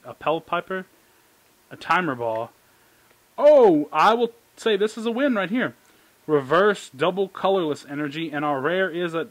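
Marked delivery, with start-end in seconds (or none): 3.4-5.8 excited. Real, not acted. Excited pull from pack.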